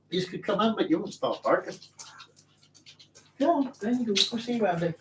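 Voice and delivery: high, soft coaxing of the dogs; talking to the dogs. Real, not acted. Shooing the dogs out.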